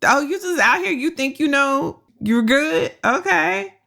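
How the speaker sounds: high, teasing disbelief at her cousin; mocking disbelief. Podcast host mocking cousin's raise plan, high teasing disbelief. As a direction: needle him like he's clueless. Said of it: "I was like".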